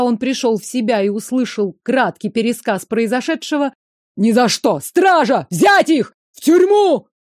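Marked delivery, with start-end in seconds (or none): 4.2-7.1 rising enraged yell, getting louder; furious shout. Acted. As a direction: bellow orders at the guards. Furious prince orders guards seized.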